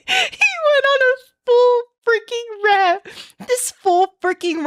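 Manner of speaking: high, laughing through the words; giggling disbelief. Real, not acted. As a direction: crack up while telling it. Laughing after his reply.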